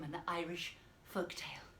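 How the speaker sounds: hushed whisper. Shown as a demonstration. Teacher ending the lesson, whispering. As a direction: breathe it out softly. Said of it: "whispered ending".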